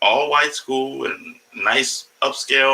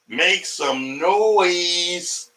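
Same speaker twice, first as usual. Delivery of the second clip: hype announcer; 6 semitones higher, much slower than usual. Pretend.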